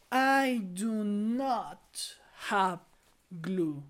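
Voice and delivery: very slow, loud teacher model with pauses; loud, drawn-out classroom demonstration; slow model sentence. Shown as a demonstration. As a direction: stretch it out word by word for young learners. Teacher models sentence slowly for pupils.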